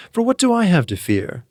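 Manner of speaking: ordinary delivery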